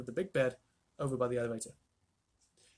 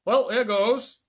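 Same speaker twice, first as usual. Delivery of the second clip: loud, high excited cry; old man's bold cry; cried out; 7 semitones higher, 10 dB louder, much slower than usual. A narrator's reading. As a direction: call it out bravely. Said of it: "cried Grandpa George".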